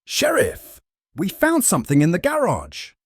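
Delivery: high, loud call from afar; shouting from outside. A narrator's reading. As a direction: yell it across the yard. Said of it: "someone shouted from outside".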